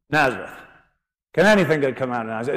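quick, scornful scoff at the town; a rapid, dismissive sneer; scoffing doubt. Acted. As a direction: toss the question off with disdain. Nathanael scoffs at Nazareth.

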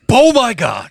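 high, exclaimed mock amazement; mock starstruck outburst. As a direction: gush like a starstruck fan. Imagined starstruck reaction.

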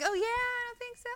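sassy dismissal. Retold or imitated. Wife mimicking her own sassy reply to husband, sarcastic. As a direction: brush it off with mock sass.

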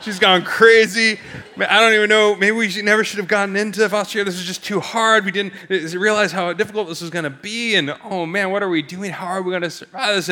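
high-pitched spiralling worry, starting loud; acted-out frantic fretting, voice pushed up; panicked husband.

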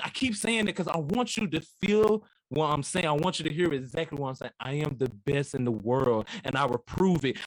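usual speaking voice

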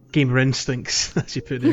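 ordinary talk

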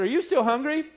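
high, bright question; postal lady asking. Retold or imitated.

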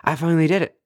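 high, bright victory cry; triumphant cheer. Retold or imitated. To herself after meditating, a high triumphant cheer. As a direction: celebrate finally doing it. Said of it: "I was like".

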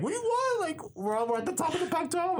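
very high-pitched retold joy; emotional elation. Broadcaster retelling almost crying after the win, high emotional elation. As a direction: imitate your overwhelmed self squealing, without full volume. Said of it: "I was so emotional".

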